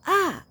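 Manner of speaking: sharp high loud "Ah!" bursting out; outburst cry. Retold or imitated. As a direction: let out a sudden venting yell. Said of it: "and I was like".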